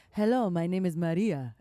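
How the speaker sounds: lightly imitated Croatian accent of the waxing lady; a hint of a Croatian accent, greeting a client; mock Croatian accent. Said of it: "her accent was, like, it was a super stereotype".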